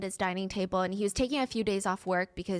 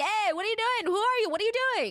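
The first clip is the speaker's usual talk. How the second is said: high, loud shouted challenge; police yelling; 12 semitones higher, 4 dB louder, faster than usual. Retold or imitated. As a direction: yell at the intruder to stop. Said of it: "The police are screaming after him like".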